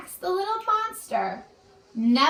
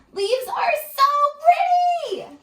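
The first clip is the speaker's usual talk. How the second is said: high, loud delighted cry; excited shout; 13 semitones higher, 6 dB louder than usual. Acted. Little monster shouting with delight at leaf piles. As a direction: burst out with joy about the leaves.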